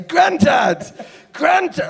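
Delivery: high, loud mock shout of granddad; mock yell. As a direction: yell it after someone down the road. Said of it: "he started shouting it after me".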